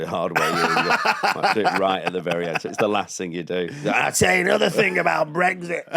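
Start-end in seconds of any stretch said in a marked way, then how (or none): 3.9-5.9 high, hammed-up tipsy blurting; drunken outburst. Drunk guest at the end of the show, mock outburst.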